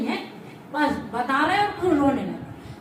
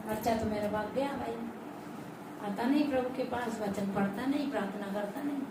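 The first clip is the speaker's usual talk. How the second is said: low, soft lament of a mother whose son strayed; a grieving mother, lower and softer; regretful mother; 7 semitones lower, 10 dB quieter than usual. Retold or imitated. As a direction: sigh it out like a mother who gave up. Mother voiced lamenting her son.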